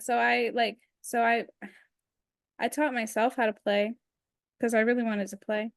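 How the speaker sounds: usual speaking voice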